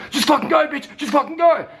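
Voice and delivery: very high yelled commands; mock drill trainer. A coked up trainer yelling at girls, high mock yell. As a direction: hammer them like a wired boot camp instructor.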